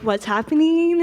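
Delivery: gasping at the size of the city; stunned by Manhattan skyline, alarmed; overwhelmed. Retold or imitated.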